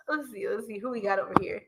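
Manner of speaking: fast whispered aside; quick hushed aside to herself. Real, not acted. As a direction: murmur to yourself while reading. Checking the chat under her breath.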